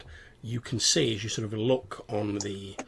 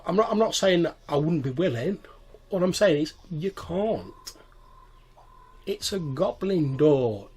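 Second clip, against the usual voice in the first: put-on explaining to a child; 7 semitones higher, 5 dB louder than usual. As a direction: spell it out like to a small child. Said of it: "as though he's trying to explain something to a child".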